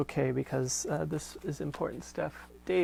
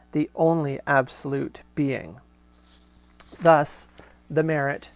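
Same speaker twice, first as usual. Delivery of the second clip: steady, fuller read-aloud voice; letter reading; 6 dB louder than usual. Acted. Host reading Corinne's letter aloud to listeners, measured reading voice. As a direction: read the old letter out with care. Reads Marshall's reply aloud.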